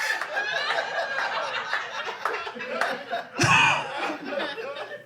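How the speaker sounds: breathless squeaky laugh; high silent wheezing laugh. Real, not acted. After upside-down joke.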